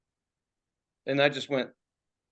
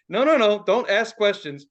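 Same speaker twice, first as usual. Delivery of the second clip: loud, higher retold warning; urgent warning; 6 semitones higher, 6 dB louder than usual. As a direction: cut off the questions, loud and insistent. Retold urgent warning to visiting companion.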